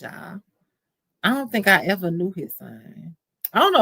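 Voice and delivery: normal talking voice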